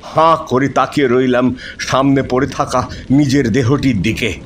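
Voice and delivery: plain reading voice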